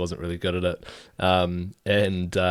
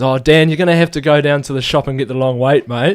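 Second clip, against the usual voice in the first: higher, louder, playing it straight; mock instruction; 5 semitones higher, 8 dB louder than usual. Workmate sending young Dan to the shop, mock earnest order. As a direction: send the apprentice off with a straight face. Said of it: "He goes".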